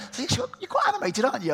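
lightly mocking high-pitched quote. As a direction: voice jumps high, amused imitation. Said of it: "I was like".

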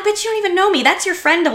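high-pitched indignant comeback; indignant retort. Retold or imitated. Firing back at a drunk near-stranger, indignant.

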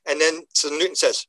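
normal talking voice

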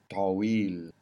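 "Tawil" with a dark T, drawn out; long "Tawil", tongue pulled back on T. Shown as a demonstration. Teacher modelling for the learner, "Tawil" with back T. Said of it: "the T that's coming from the back".

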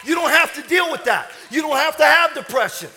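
loud, high, punchy sermon shout; full-force preaching. Real, not acted. Preacher telling the congregation fear is only a spirit, shouted with fervor. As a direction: drive each phrase out like a fired-up preacher.

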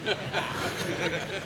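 soft chuckle. Real, not acted. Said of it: "not sure if that'd be the Christ-like thing".

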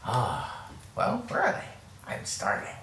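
put-on proud old woman, high sigh sinking soft; old woman mentor. Old mentor proud of dear boy, old woman's voice. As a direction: coo like an elderly teacher. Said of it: "Your, your mentor".

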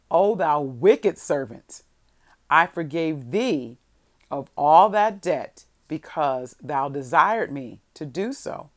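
loud, slow, declamatory rebuke; stern scripture reading. A narrator's reading. King rebuking the wicked servant, stern and declamatory. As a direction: proclaim the verse slowly and loudly, with weighty pauses. Reads king's rebuke from verse.

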